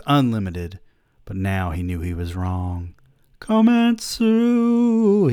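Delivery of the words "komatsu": high, drawn-out yell of a name; long high anguished cry. Acted. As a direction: call out desperately across the playground. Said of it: "he yelled".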